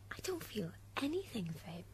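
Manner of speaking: hushed, quiet admission; whispered confession. Acted. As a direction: whisper it privately to counsel. Whispered aside to counsel.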